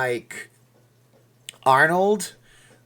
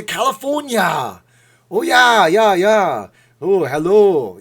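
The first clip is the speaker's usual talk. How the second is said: loud, high Austrian strongman yell; big hammed-up action-hero hollering; Arnold; 6 semitones higher, 10 dB louder, slower than usual. Shown as a demonstration. Streamer demonstrating for viewers, yelled Arnold impression. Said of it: "you can start yelling as Arnold".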